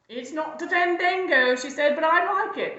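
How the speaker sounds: high, loud sheep voice; sheep character. Acted. Sheep answers the dancing goat, high and loud.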